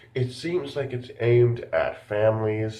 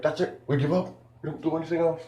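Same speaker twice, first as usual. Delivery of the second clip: higher-pitched imitation of quitting; mock giving up; 6 semitones higher, faster than usual. Reviewer mimicking Doctor Doolittle, mock defeated. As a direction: lightly act out throwing in the towel.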